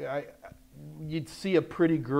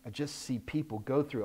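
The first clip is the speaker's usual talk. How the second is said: ordinary talk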